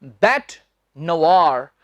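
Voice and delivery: बैट नवार said "bet nuh-vaar", slow; correct pronunciation. Shown as a demonstration. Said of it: "इसका उच्चारण है".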